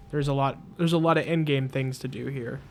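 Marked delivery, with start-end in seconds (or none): none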